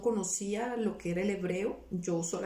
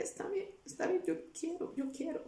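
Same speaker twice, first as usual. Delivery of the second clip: high, soft, giving in at last; a hushed, higher yes; soft surrender; 8 semitones higher, 6 dB quieter than usual. Retold or imitated. Surrendering on the phone to the woman, soft and high.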